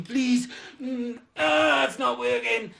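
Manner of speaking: high, slow whine of agony; desperate pleading. Retold or imitated. Man chewing painkillers for instant relief, pleading in re-enacted agony. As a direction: whimper it high, begging for relief.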